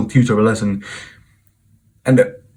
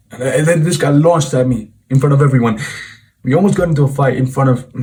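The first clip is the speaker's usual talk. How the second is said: usual speaking voice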